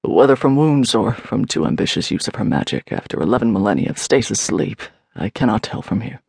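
low, grave warrior priest voice; sombre, deepened male character voice; grim. A narrator's reading. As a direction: report grimly, pitched far down. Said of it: "Alaric said grimly".

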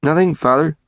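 reply weakly, as if caught out; a son's lame excuse; feeble reply. A narrator's reading. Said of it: "in rather feeble accents".

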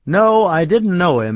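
slow, careful denial; slowly. A narrator's reading. George carefully tells the clerk he didn't know him, slowly. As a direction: weigh each word before letting it out. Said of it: "he said slowly".

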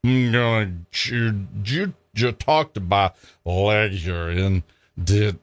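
put-on slow, slurred drunken bluster; drunk slurring threat. Drunk Freddie threatening the cop with his lawyer, slurred. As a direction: mumble a threat through the booze.

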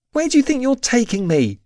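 high-pitched, alarmed demand; indignant alarm, pitched high; alarmed protest. A narrator's reading. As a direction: demand an answer in a high, anxious voice. Heroine demands as she is dragged.

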